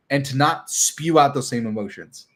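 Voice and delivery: normal talking voice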